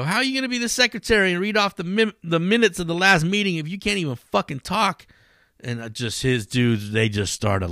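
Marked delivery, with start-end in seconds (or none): 0.0-5.1 mocking retort. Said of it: "talking back to him and going".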